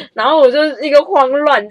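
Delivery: giggling while telling it; real laughter; laughing. Real, not acted. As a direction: laugh through the words.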